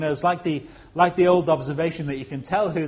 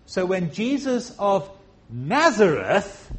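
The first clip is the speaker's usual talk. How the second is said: ordinary talk, nothing marked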